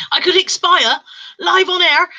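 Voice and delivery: strained high voice after coughing; real choked-up exclamation; recovering from choking. Real, not acted. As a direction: push it out loud between coughs. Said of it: "I'm actually choking to death".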